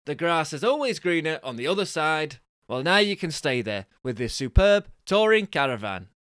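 lively prize-reveal patter; punchy announcer read, easing after the first phrase; game show prize announcer. Acted. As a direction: sell the prizes with showy cheer.

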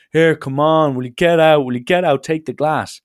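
retold shouts from the queue; mock crowd yelling. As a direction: heckle like an impatient queue. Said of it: "people were screaming at me".

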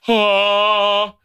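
long, high imitated gasp; shocked gasp. Daughter hears about the goatee, high shocked gasp. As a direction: draw out a mock horrified gasp.

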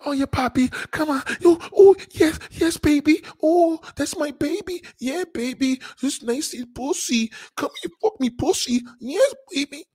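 very high, loud hammed-up cries; overdone, performed passion. A comic imitating a Spanish woman putting on her accent. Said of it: "the Spanish woman be like".

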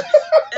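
big high laugh; loud burst of laughter. Real, not acted. Laughing after the retold squeal.

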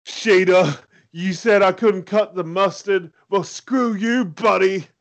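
high, loud trash-talk promo; wrestling promo. Retold or imitated. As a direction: rant at a rival like a heel. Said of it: "she'll cut a promo like".

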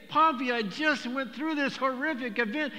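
put-on toddler voice. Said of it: "She was saying".

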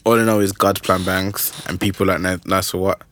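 normal talking voice

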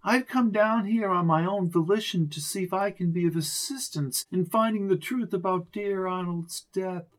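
lightly voiced grieving widow. A narrator's reading.

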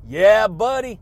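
loud, hyped cheer; loud catchphrase. Real, not acted. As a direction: shout it like a hype man. Shouted catchphrase.

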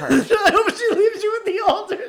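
very high, loud squeal through laughter; hysterically amused imitation; mocking shriek. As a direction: parrot the curse in a hammed-up screech. Mimics the parting curse, laughing.

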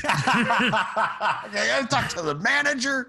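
high, slow laughing Karen voice; entitled customer impression. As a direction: whine like an entitled diner. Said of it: "Complaining at a restaurant".

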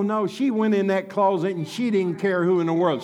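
ordinary talk

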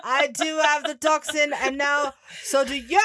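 hammed-up Scottish villain reveal; bad Scottish accent, played for laughs; terrible Scottish accent. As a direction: gloat in a badly faked Scottish accent. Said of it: "terrible Scottish accent for you".

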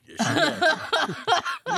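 high, very drawn-out sing-song yes; mock cheery server. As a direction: stretch it out like an overeager cashier. Playful drive-thru reply.

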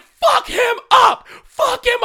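very high, loud drawn-out yell. Retold or imitated. Podcaster reenacts yelling at the villain in the theater, high shout. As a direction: reenact an all-out cheer for a beating. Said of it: "I yelled that in the theater".

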